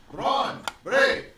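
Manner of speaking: put-on high, loud yell for the boys. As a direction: call them over at full shout. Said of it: "He's yelling".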